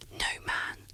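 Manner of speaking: ordinary delivery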